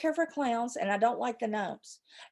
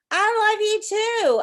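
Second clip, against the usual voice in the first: gushing; 11 semitones higher, 6 dB louder, slower than usual. Real, not acted. Answering a viewer's love.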